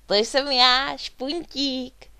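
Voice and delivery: high, loud proud announcement; proudly calling out. A narrator's reading. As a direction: announce yourself proudly to everyone. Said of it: "volal Špuntík pyšně".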